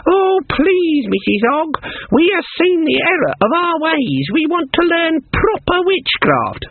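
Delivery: high squeaky mimicry of silly girls; mock the girls in a simpering falsetto; old witch mimicking girls in falsetto. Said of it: "Her voice went falsetto".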